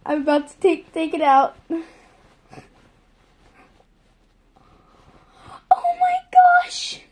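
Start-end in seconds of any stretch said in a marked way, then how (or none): 1.7-7.0 high squeal of delight; surprised squeal. Real, not acted. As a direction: squeal as the toy comes out. Unboxing the plush toy.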